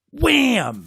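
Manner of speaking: high, loud, punchy Wham; loud sound effect. Acted. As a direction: smack it out like a comic-book hit. Swatting monster with broom.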